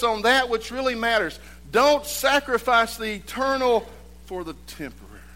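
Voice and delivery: loud, high fervent preaching; full force preaching. Acted. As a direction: preach it out to the whole church.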